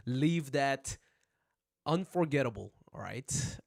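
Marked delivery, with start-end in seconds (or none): none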